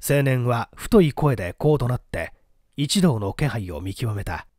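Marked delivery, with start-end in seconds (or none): none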